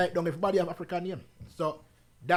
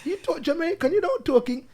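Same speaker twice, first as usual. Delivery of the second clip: put-on much higher, louder child voice; daughter's voice, high and emphatic; imitating daughter; 11 semitones higher, 6 dB louder than usual. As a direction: play the daughter declaring it, pitched well up. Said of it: "she said".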